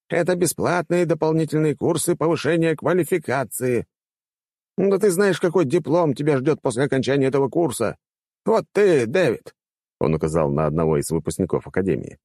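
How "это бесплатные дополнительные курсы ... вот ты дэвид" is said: high-pitched, insistent rector; indignant old rector. Acted. Rector justifying the courses to graduates, insistent. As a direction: lecture the pupils in a high old-man voice.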